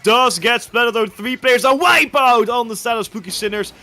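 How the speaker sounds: loud, high esports casting; excited play-by-play. Real, not acted. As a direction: shout the action as it unfolds.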